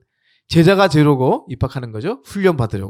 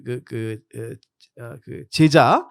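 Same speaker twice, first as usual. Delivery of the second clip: normal talking voice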